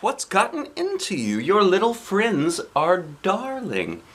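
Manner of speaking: high, slow, shocked but sweet; shocked grandma. Acted. Grandmother to Lucille, high shocked sweet tone. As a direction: gently scold the granddaughter in surprise. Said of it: "very shocked".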